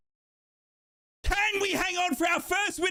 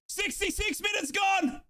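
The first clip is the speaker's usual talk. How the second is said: ordinary talk, nothing marked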